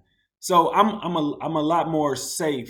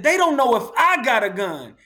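higher, louder, cocky comeback; cocky retort; 8 semitones higher, 4 dB louder than usual. Retold or imitated. Answering the cautious friend, cocky. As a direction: fire back at the friend, swaggering. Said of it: "I said".

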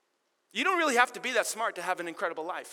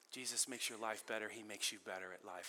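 much softer and lower, intimate; soft, lowered restatement; hushed refrain; 11 semitones lower, 11 dB quieter than usual. Shown as a demonstration. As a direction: say it low and quiet, almost to yourself. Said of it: "repeated refrain, hushed take".

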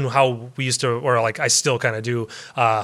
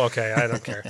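normal talking voice

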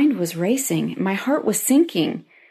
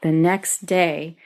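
ordinary delivery

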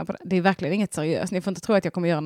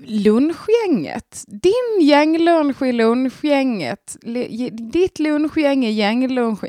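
slow, bright host voice stretching the show name; radio show host opening; 5 semitones higher, 6 dB louder, slower than usual. Acted. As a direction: announce the show like a radio jingle. Radio show opener jingle voice. (långsamt programledarintro)

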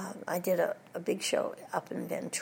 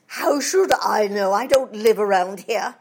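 Dowager Duchess; 9 dB louder than usual. Retold or imitated. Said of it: "She says".

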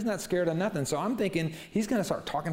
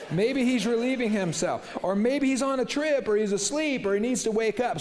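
much higher, louder gleeful jeering; mocking taunt; 9 semitones higher, 4 dB louder than usual. Elijah piling taunts on Baal's prophets, high gleeful jeer. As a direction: pile on the taunts, climbing high. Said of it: "He's talking trash to them".

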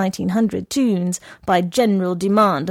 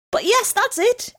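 much higher eager exclamation; exclaimed. A narrator's reading. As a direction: jump in to cover for him. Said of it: "exclaimed the woman".

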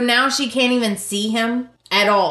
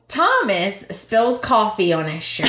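usual speaking voice

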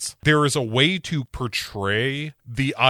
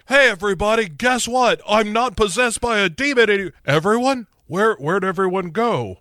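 much higher, eager and fast; calling out excitedly; 9 semitones higher, faster than usual. Acted. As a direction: announce the news to an empty room. Voicing Cage calling out.

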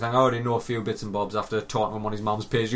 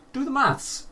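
emphatic high repeat; 14 semitones higher, slower than usual. Real, not acted. Host urging listener to add the costs, emphatic high repeat. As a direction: do the maths, jumping much higher on the repeat.

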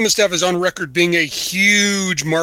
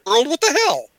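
high-pitched, baffled reaction; hammed-up disbelief; mock bewilderment; 8 semitones higher than usual. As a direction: imitate a viewer caught off guard. Retold baffled viewer reaction.

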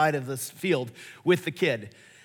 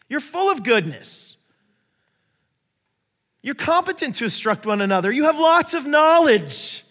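Paul encouraging; 6 semitones higher, 5 dB louder than usual. Retold or imitated. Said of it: "He's basically saying".

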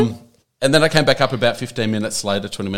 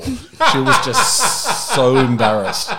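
slow, higher, louder through real laughter; laughing; 4 semitones higher, 7 dB louder, much slower than usual. Real, not acted. Telling friends how embarrassed she was, laughing. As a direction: draw it out while cracking up.

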